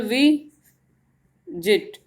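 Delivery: Visit with a long वी, a pause, then जिट; split into syllables. Shown as a demonstration. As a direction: stretch and separate the first syllable of visit. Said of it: "यानी कि first syllable". (Visit में वी लंबा, फिर रुककर जिट)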